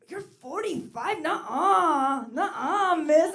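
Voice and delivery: slow, high, loud mock disbelief; disbelieving young guy. Young guy refusing to believe she is forty-five.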